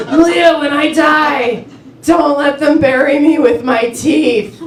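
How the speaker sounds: put-on mom's accent. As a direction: imitate mom solemnly making a request, drawn out. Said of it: "She has an accent, but I don't do impersonations".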